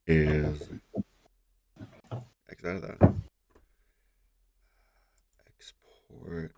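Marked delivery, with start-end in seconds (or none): 2.5-6.5 whispered. Real, not acted. Reading menu aloud to self.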